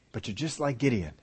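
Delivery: usual speaking voice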